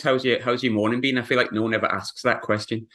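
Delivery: normal talking voice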